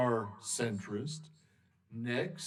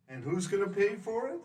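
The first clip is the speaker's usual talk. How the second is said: quick skeptical customer; 8 semitones higher, faster than usual. Acted. Customer asks, fast.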